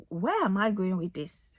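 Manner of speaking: ordinary talk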